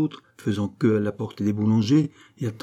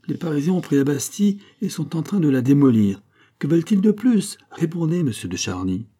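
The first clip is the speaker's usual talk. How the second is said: high, louder, rising indignation; indignant outburst; 7 semitones higher, 3 dB louder than usual. A narrator's reading. Queen demanding answers from Charny, indignant and high. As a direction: demand an answer, rattled and imperious.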